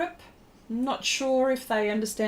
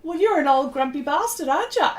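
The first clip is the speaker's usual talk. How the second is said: mock retort; 6 semitones higher, 4 dB louder than usual. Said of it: "I'm thinking".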